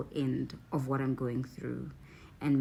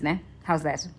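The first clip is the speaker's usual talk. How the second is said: ordinary talk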